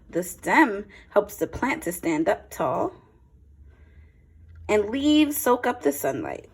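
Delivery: clear, lively picture-book read-aloud; a teacher's storytime voice, a touch brighter; reading to children. Acted. Reads picture book to class.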